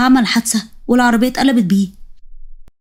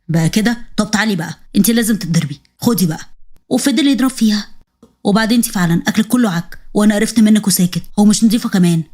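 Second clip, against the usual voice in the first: violent husband; 2 semitones lower than usual. Acted. Husband beats wife.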